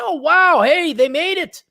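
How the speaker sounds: high, loud cheering; mock celebration. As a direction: cheer them on with stretched-out delight. Models the celebration he urges.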